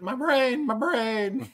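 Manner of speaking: high-pitched mock whimper; mock distress. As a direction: wail it up high as if the swab hit. Acted reaction to deep nose swab.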